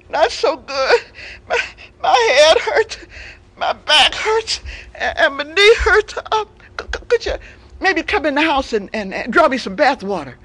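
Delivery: milk the pity like a fake invalid; feigned sick lion. Lion fakes being sick.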